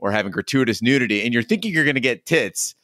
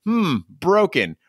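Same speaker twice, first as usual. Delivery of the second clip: imitating dad; 8 semitones higher, slower than usual. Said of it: "he's like".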